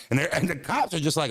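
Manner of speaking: ordinary talk, nothing marked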